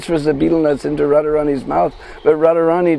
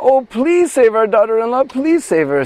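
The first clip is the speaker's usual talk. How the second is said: higher, urgent plea to save her; pleading cry; 6 semitones higher than usual. A narrator's reading. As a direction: beg anxiously for the girl's life. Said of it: "And Jatila cries out".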